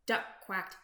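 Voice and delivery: ordinary delivery, nothing marked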